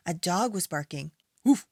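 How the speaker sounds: ordinary delivery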